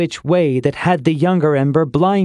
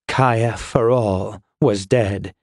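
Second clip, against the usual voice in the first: grave; 5 semitones lower, slower than usual. A narrator's reading. Grief at the death.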